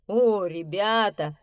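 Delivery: slow, glad welcome; happy bear greeting; delighted. A narrator's reading. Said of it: "обрадовался медведь". (радостно)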